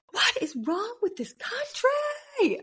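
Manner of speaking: high, slow, whispered exasperation; breathy disbelief, way up high; whispered mock despair. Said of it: "I literally was like".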